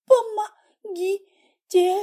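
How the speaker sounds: high, drawn-out strained plea; pained struggle to speak. A narrator's reading. Patient waking in pain begs for help, struggling to speak. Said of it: "с трудом выговорила она".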